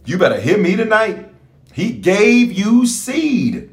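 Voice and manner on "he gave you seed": He gave you seed drawn out slowly; slow, deliberate preacher's refrain; slow emphatic preaching. Acted. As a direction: lay each word down slowly, like a sermon point.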